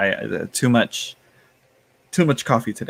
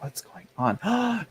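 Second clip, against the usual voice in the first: curious inner voice; 10 semitones higher, 5 dB quieter, much slower than usual. Retold or imitated. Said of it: "A part of me was like".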